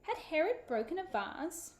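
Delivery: plain reading voice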